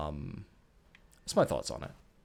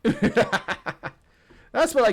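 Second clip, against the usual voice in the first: laughing through the words, slowly; 12 semitones higher, 7 dB louder, much slower than usual. Real, not acted. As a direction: giggle out the line at yourself. Laughs after playing worse.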